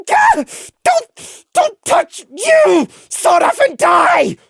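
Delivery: very high, loud, furious yell; very high, savage outburst; snarling shout. A narrator's reading. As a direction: lash out at the one touching him. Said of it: "He twisted with a snarl".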